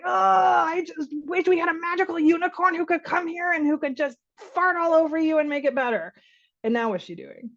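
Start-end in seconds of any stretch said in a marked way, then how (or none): 0.0-6.1 high, theatrical mock lament; playful fantasy wishing. Parent distracting a hurting child, playful mock wail. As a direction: wish aloud with hammed-up longing.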